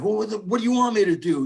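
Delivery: hammed-up defensive rocker snapping back; voicing Eddie. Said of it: "And he's like".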